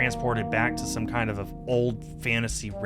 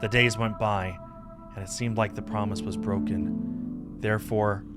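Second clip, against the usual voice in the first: measured, slightly lower story narration; legend storytelling; 4 semitones lower, 5 dB quieter than usual. Acted. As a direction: tell the old legend in an even, unhurried voice.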